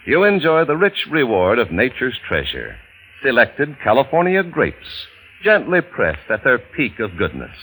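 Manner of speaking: ordinary talk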